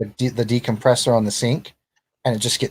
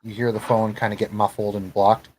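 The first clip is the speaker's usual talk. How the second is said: ordinary talk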